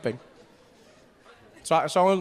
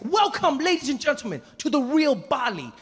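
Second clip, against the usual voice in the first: high, showman tour guide; grand high-pitched welcome, slowing at the end; Deng Basan; 7 semitones higher than usual. Acted. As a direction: proudly present it to the group. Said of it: "And he's like".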